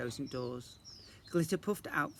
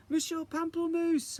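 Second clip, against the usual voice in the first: high, loud called name; calling out; 6 dB louder than usual. Acted. Isadora calling her teacher, calling out loud. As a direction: call across the room. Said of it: "I called".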